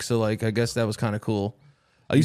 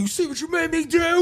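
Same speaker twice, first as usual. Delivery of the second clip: very high-pitched hillbilly wife outburst; hillbilly accent. Acted. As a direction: screech it as a mock hillbilly wife. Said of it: "I don't know why I give that accent".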